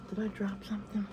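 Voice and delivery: unvoiced whisper to herself. Real, not acted. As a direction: whisper the aside. Whispered aside.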